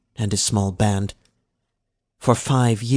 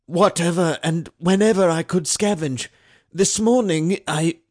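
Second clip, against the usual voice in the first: hurried answer; 8 semitones higher than usual. A narrator's reading. Slave answers, cut off.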